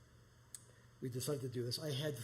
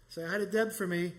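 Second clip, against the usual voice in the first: calling out; 5 semitones higher, 9 dB louder than usual. Retold or imitated. Stranger calls while waving.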